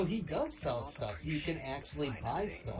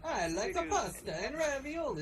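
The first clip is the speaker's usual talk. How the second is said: mock Italian Mario voice; 12 semitones higher than usual. Voice actor auditioning as Mario, hammed-up Italian accent. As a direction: squeak out a cartoon Italian plumber, slowing on "I'm an Italian". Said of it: "do the voice of an Italian plumber".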